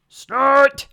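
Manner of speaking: high, loud machine snort. Acted. The digger answers the baby bird with a loud snort. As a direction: blast it out like a huge snort. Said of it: "the big thing just said".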